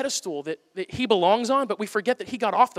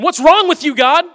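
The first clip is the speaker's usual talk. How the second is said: David complaining; 9 semitones higher, 12 dB louder than usual. Retold or imitated. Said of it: "with David going".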